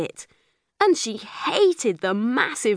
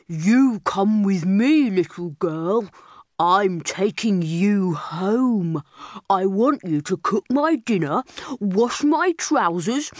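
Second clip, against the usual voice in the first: bossy bear. A narrator's reading. Big bear tells captured girl to work for him. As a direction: growl orders at the little girl.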